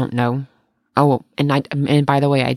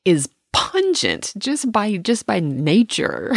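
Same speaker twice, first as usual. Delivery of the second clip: higher, slowing to a drawn-out pungent; quoting a friend; 6 semitones higher than usual. Retold or imitated. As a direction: savor the word pungent, slow and lifted. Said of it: "and she's like".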